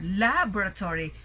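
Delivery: LA-boratory, strong start then falling away; stress on first syllable. Shown as a demonstration. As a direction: punch LA in Laboratory, rest unemphasized. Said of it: "volume of our voice is also louder".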